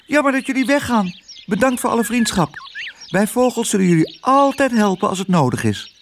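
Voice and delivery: high, chattering bird voices; twittering birds. A narrator's reading. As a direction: pipe out a chirpy farewell. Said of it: "kwetterend goeiedag te zeggen". (hoog kwetterende vogels)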